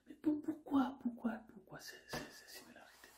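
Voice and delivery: hushed, secretive whispered question; mysterious whisper. Acted. Narrator whispering a mysterious question to the audience.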